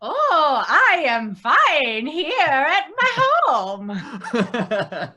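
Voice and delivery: a put-on Swedish accent, slow with a laugh. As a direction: play the Swedish character, laughing through it. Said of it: "so you can drop the Swedish act".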